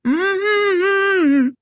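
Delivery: high, long closed-mouth hum; gagged muffled cry. A narrator's reading. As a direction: plead through a taped mouth. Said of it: "struggling to talk through the gag".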